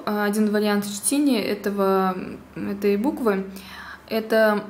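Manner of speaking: ordinary delivery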